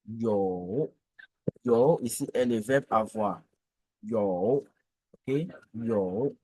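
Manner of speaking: Yo low and dipping; third tone. Shown as a demonstration. Teacher drilling Yo in the third tone. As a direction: drop Yo low then lift. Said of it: "le troisième ton".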